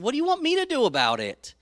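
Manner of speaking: exasperated retort. Acted. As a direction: toss it back at her, high and quick. Jesus retorts to his mother.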